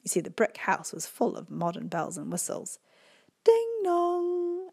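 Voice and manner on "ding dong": high, loud ding dong chime; doorbell imitation. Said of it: "it went".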